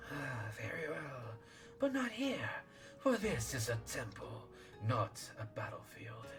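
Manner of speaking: soft, hissing serpent lord. Acted. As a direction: breathe it like a sly sea serpent. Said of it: "I'll do serpent voice then".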